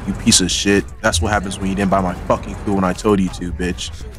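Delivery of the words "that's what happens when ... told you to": ordinary talk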